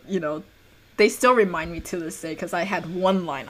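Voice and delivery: ordinary talk, nothing marked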